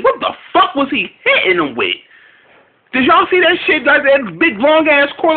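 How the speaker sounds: can't believe what he saw; incredulous. Real, not acted. Incredulous rant about the cord.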